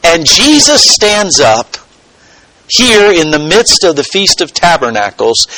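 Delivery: usual speaking voice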